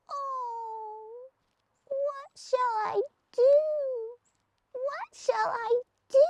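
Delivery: a tiny creature fretting aloud; sad little lizard. A narrator's reading. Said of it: "she heard a sad voice".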